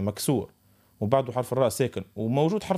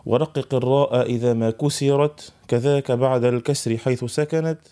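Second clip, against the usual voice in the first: slow chanted recitation of the poem; recited verse; 4 dB louder, slower than usual. Shown as a demonstration. Teacher recites Ibn al-Jazari's poem to students, slow chant. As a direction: intone the verse slowly like tajwid. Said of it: "ماذا يقول في منظومته".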